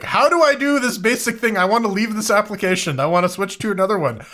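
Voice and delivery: high, exasperated pleading voice. Retold or imitated. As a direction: whine about the basic thing. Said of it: "I'm like".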